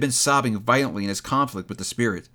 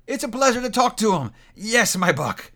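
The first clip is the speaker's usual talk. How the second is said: high, jovial exclaiming; chuckle-free glee, hearty and high; delighted; 10 semitones higher than usual. A narrator's reading. Scrooge pleased talking to the boy, delighted.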